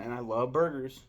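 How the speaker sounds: ordinary delivery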